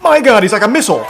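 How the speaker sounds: high, fast, loud outburst; amazed shout. Real, not acted. Viewer watching a man fly at the boards, excited shout.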